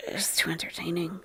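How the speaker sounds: a hushed amused aside to the stream; whisper. Real, not acted. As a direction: breathe it out under your voice. Aside while pausing the clip.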